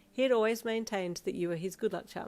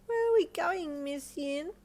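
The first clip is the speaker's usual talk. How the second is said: put-on high, curious child; little girl voice; 11 semitones higher, 4 dB louder, slower than usual. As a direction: ask like a small girl. Child asking teacher.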